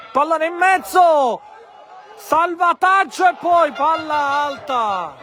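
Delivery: shouted, high match call; urgent as the ball comes in; excited commentary. Real, not acted. Dangerous cross, commentator shouts. (telecronaca concitata)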